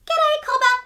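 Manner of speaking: very high, tiny chorus of ants; tiny voices. Acted. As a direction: squeak it like a hundred tiny ants. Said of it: "came a hundred tiny voices from down below".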